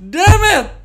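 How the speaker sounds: loud high outburst of frustration; frustrated shout. Real, not acted. Gamer yelling at himself over a forgotten map spot. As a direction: yell it out in sudden annoyance.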